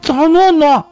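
put-on very high toddler voice; little girl's unclear speech. A narrator's reading. Little girl echoing her brother, high lisping child voice. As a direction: copy big brother in high baby talk. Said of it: "女孩跟着哥哥学，话还说得不大便利".

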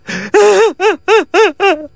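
high, pulsing fake sobs; mock wailing. A narrator's reading. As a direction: keep up a theatrical wail in bursts. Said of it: "还在哭".

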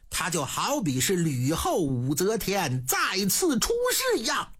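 ordinary delivery, nothing marked

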